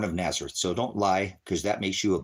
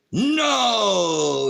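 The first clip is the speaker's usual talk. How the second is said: 1 sharp loud no; 10 semitones higher, 5 dB louder than usual. Real, not acted. Arguing scripture with David.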